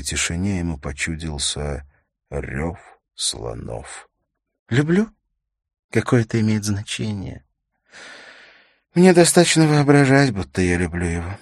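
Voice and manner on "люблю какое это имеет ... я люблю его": high, loud woman's voice; high-voiced acted yearning; desperate widow. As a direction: confess it with pitch and volume raised. Widow confesses need for love.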